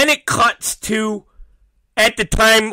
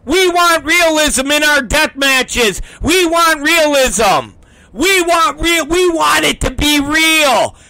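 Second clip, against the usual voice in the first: high, whiny-crowd mimicry of a demand; mock their demand in a raised, insistent voice; mocking fans; 5 semitones higher than usual. Mocking deathmatch fans who demand realism, high and insistent.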